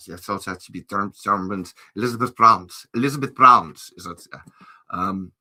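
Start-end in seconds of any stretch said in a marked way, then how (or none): 1.9-2.8 "Bruns" said as "Brrums", German-style; German pronunciation. Shown as a demonstration. Saying the fan's name in a German accent. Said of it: "it's a German". 2.9-3.9 "Bruns" said as "Brrums", German-style; louder German "Elizabeth Bruns"; German pronunciation. Shown as a demonstration. Saying the fan's name in a German accent again. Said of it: "it's a German".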